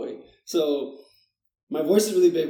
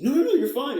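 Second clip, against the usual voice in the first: boss reassuring; 11 semitones higher, 6 dB louder than usual. Retold or imitated. Boss reassuring him on desk.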